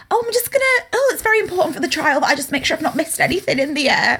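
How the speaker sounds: high-pitched, hurried excuse with amusement creeping in; mock judge's wife. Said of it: "That judge's wife was like".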